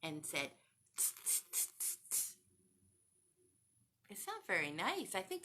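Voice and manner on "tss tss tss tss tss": unvoiced "tss" monkey clicks; monkey chatter. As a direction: chitter back like cheeky monkeys with short tss sounds. Monkeys answering the peddler.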